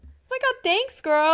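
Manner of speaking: high bubbly thanks; gushing thanks. Retold or imitated. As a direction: squeal a friendly thank-you. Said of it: "everybody to be like".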